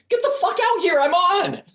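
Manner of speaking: very high, loud shriek of excitement; desperate excited shouting; frantic yell. Acted. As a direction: scream for him to hurry, he's on. Steve yells for Dave to come.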